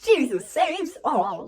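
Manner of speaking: slow, mock-pious commenter. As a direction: drag it out like a smug churchgoer. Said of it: "people being in the comment section like".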